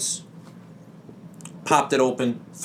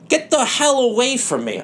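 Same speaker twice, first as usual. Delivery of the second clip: high yelled retort, much higher; shoving her off with a yell; mock shout; 11 semitones higher than usual. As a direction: bark it at her, high and sharp. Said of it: "And I go".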